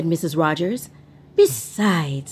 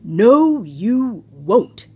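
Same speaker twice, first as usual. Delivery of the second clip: lightly voiced husband, louder; husband asking the maid; Mister Rogers voice; 4 semitones higher, 6 dB louder, much slower than usual. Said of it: "said Mister Rogers".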